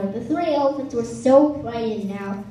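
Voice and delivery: usual speaking voice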